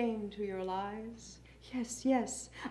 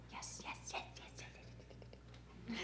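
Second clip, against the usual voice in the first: whisper, much softer, voiceless; whispered refrain; 24 dB quieter, faster than usual. Acted. Poet answering the name-callers in a whisper. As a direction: whisper a run of repeated yeses.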